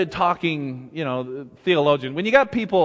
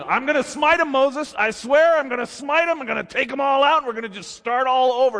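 furious God; 9 semitones higher than usual. Retold or imitated. God threatening Moses to wipe the people out, angry. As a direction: fume like you're done with them. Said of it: "God is going".

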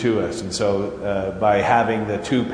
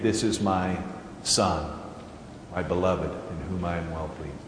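much softer and lower, almost breathed; hushed reverent repeat; 7 semitones lower, 12 dB quieter than usual. Shown as a demonstration. Preacher repeating the heavenly declaration to the congregation, hushed. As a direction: let it fall away to a hush. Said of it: "that declaration is reiterated".

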